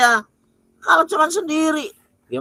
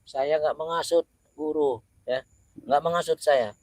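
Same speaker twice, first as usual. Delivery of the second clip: low possessed voice; 9 semitones lower, 9 dB quieter than usual. Acted.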